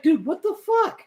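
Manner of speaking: high-pitched incredulous exclamation; retold disbelief. Retelling a reaction to ridiculous motion blur, high disbelief. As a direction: voice jumps up in mock outrage.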